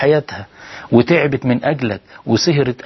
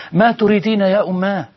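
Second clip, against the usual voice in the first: higher, raised reply to his mother; son answering loudly; 7 semitones higher than usual. Retold or imitated. Son answering his mother's call in a raised voice. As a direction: answer her call even louder than she did. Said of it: "بصوت أعلى من صوتها".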